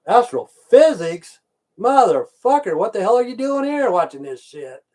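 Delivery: slow, loud question, then a high shout; stunned disbelief. Real, not acted. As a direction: blurt it out, floored by the news. Shocked at viewer's degree.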